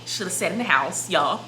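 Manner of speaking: ordinary talk, nothing marked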